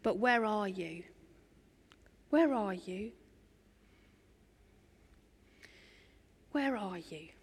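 soft, hushed longing call; quietly seeking the lost; gentle calling. Acted. God tenderly seeking Adam and Eve.

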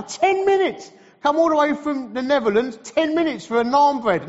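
high, indignant echo; can't believe how short it is; incredulous outrage. Acted. Indignant echo of the host's answer.

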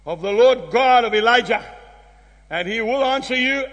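normal talking voice

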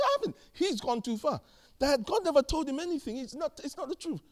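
high-pitched retold protest; protesting son. Retold protest back to his dad.

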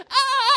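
high loud scream on horseback; shrieking as the horse bolts; retold scream. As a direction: yell out in panic like a runaway rider. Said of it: "and I'm going".

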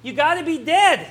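little John blurting the punchline; kid shouting back. Acted. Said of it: "he shouted back".

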